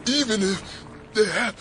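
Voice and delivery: high, strained, halting cry; dying warning. Acted. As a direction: push each phrase out in agony. Dissolving figure struggles to speak.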